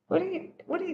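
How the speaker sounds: quick, high coo at the cat; pet talk. Real, not acted. Talking to her cat.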